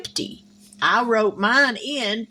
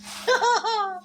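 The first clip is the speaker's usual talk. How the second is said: light laugh; 11 semitones higher than usual. Real, not acted. Laughing at own joke.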